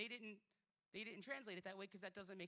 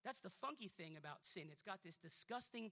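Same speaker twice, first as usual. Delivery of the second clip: normal talking voice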